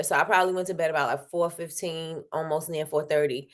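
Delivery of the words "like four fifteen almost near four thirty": normal talking voice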